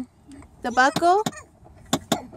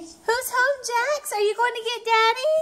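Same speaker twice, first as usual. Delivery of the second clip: welcoming someone home; 9 semitones higher than usual. Real, not acted. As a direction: greet him like great news. Mom greeting dad home.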